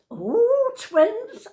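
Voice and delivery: high, loud swooping squeal of delight; buzzing with excitement. Retold or imitated. Nan to her granddaughter about twins, buzzing. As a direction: re-enact a thrilled outburst, pitch soaring. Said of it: "buzzing. I was on a high".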